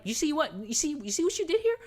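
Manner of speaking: high, loud, exasperated telling-off; acted I-told-you-so outrage; indignant scolding. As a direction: scold with rising disbelief, stumbling over repeats. Scolding a film character, retold.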